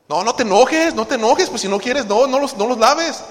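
reclamo fuerte y agudo, actuado; pleito de pareja. Acted. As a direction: salta a la defensiva, atropellando las frases. Acted spouse quarrel over dishes. (reclamo exagerado de esposa)

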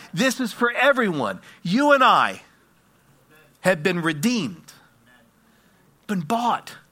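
impassioned proclamation with weighty pauses; full-force preaching. Acted. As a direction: hammer each short phrase to the congregation.

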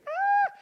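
very high-pitched mock scream; high scream, held back in volume; imitated child's scream. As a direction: squeal like a hurt little boy. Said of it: "And he's screaming".

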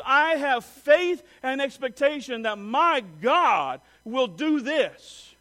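slow, ringing statement of faith; bold public declaration. Acted. Believer standing in front of other people, bold declaration. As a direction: proclaim it loudly, stretching the key words. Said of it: "When you stand in front of other people".

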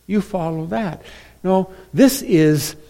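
normal talking voice

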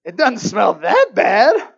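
slow, loud mock denial; loud protest. Man dodging the kitty litter chore, loud protest. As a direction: belt out the excuse.

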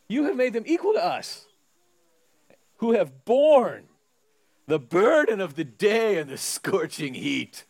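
slow, drawn-out complaining worker; grumbling laborers protesting the equal pay; whiny grumbling workers. Acted. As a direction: whine about the heat and unfairness.

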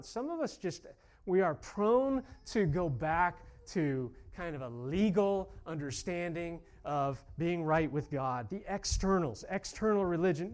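loud, driving sermon cadence; rally the congregation with conviction; preacher urging the church to major on the gospel; fervent preaching. Acted. Preaching at full force.

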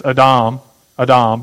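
"Adam" as ah-DAHM, stress on the second syllable; Hebrew pronunciation. Shown as a demonstration. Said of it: "they pronounce it different".

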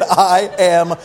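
slow mock-polite calm, each word spaced; slow, deliberate. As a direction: spell out every word slowly through a smile. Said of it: "I put a smile on my face".

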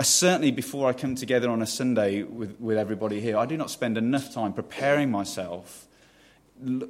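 ordinary talk

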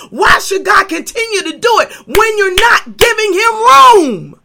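loud, high, rising pulpit climax; full-force preaching. Real, not acted. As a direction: build each question like a hammer blow.

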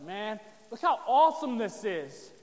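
loud, slow, wide-eyed mock awe; awed disciple. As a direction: gush over the temple like an awed disciple. Excited disciple voiced in story.

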